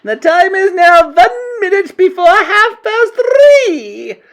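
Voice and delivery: very high, loud, slow witch proclamation; announcing witch. A narrator's reading. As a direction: announce it in a stretched witchy screech. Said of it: "announced the Grand High Witch".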